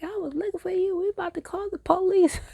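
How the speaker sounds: put-on a scared mom finally finding her kid; frantic mother. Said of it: "She's like".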